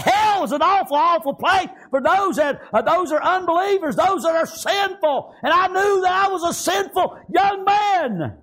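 high, shouted sermon climb; preaching at full force. Acted. Preacher recalls being shown hell, rising shout. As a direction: build it up to a shout.

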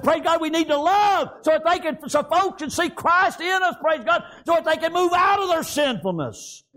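high, shouted pulpit exhortation; impassioned preaching. Real, not acted. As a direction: cry it out to the congregation. Preaching at full force.

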